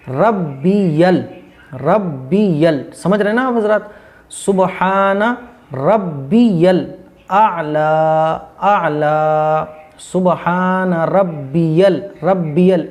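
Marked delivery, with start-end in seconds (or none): 0.1-2.8 very slow "रब्बियल" in three syllables; correct pronunciation. Shown as a demonstration. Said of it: "ये गलत है". 4.3-12.9 slow "सुबहान रब्बियल आला", syllables spaced apart; correct recitation. Shown as a demonstration. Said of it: "इस तरीके से होगा".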